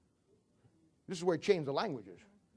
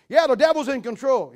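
louder, higher, reporting bad news; raised mock complaint; 4 semitones higher, 9 dB louder than usual. Angel reporting on the devil to God, raised voice. Retelling God's dialogue with angel.

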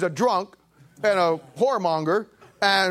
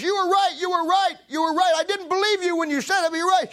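excited lady; 11 semitones higher than usual. Retold or imitated. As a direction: hammer the praise like a thrilled churchgoer. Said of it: "She says".

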